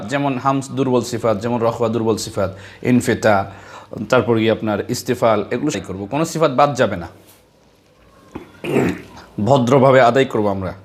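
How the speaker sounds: usual speaking voice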